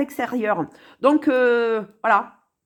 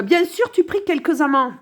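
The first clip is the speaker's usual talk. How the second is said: usual speaking voice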